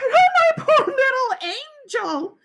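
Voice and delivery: high, drawn-out motherly wail; distraught cry. A narrator's reading. Mother fussing over her son, high distraught wail. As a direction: lament over the hurt child. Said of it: "my mother cried".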